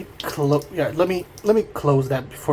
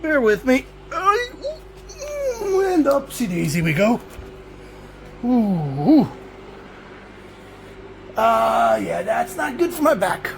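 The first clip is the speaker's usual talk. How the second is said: strained effort getting up; groaning up out of the chair; 8 semitones higher than usual. Real, not acted. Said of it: "Hold on, now I need to get up".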